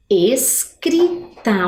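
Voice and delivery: "Escrita" very slowly as es-cri-ta; syllable by syllable. Shown as a demonstration. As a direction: part each syllable of "Escrita". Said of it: "Vamos conferir?". ("Escrita" sílaba por sílaba, devagar)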